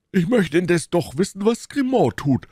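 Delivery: very high, put-on character voice; insistent Porthos. A narrator's reading.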